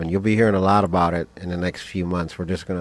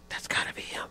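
imitating onlookers' hushed whisper; secretive, voiceless aside; whispered gossip; 10 dB quieter than usual. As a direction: whisper it behind a hand. Onlookers whispering about him, retold.

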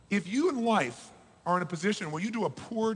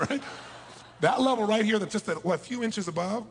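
ordinary talk, nothing marked

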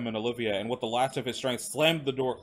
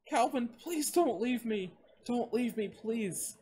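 high, weakening tearful plea; spent, desperate high voice; pleading and begging; 12 semitones higher, slower than usual. A narrator's reading. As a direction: beg slowly, fading to softness. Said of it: "who was pleading and begging on the ground".

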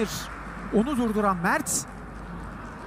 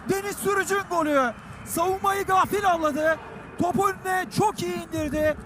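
excited commentator; 7 semitones higher than usual. Real, not acted. Goal celebration commentary.

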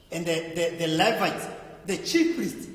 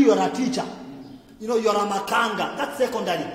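normal talking voice

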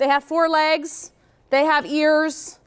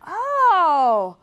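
put-on kid's dawning realization; 3 semitones higher, much slower than usual. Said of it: "and they're going".

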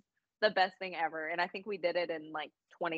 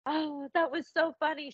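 imitating daughter; 9 semitones higher than usual. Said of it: "And she was like".

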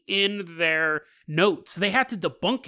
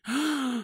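sharp higher gasp of waking; 7 semitones higher, 4 dB quieter than usual. Acted. Zoya waking on the eve of Easter, sharp gasp. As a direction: gasp as if coming back to life.

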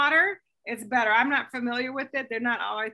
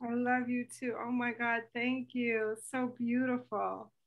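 softer, moved thank-you; touched and tender; 8 dB quieter than usual. Real, not acted. Host answering the fan's tribute, softly moved. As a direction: let it land softly, deeply touched.

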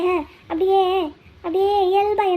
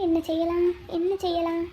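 hushed self-talk; 6 dB quieter than usual. Acted. Vennila wondering to herself what to do, hushed. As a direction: think aloud under the breath.

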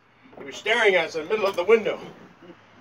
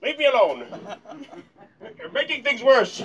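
higher-pitched mock-distressed complaining; comic exasperated whine; 6 semitones higher, 3 dB louder than usual. Comedian whining at hecklers through smoke, mock-distressed. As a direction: whine like you're having a rough time.

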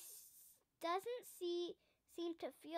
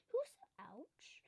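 a hushed, higher whispered question; 5 semitones higher than usual. A narrator's reading. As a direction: whisper as if sneaking up on someone. Father searches for hidden cub.

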